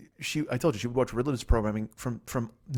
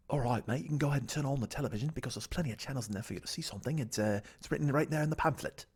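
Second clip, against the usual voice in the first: quick, put-on British accent; 6 dB quieter than usual. British housemate explaining the channels to guest, British accent. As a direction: do the British housemate properly, rattling along. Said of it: "I gotta do his British accent proper".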